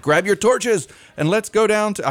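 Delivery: high, loud hammed-up British accent; a badly faked British counselor accent; terrible British accent. Counselor calling campers to the campfire, bad British accent. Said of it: "terrible British accent".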